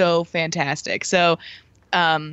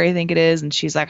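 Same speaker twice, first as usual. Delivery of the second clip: ordinary talk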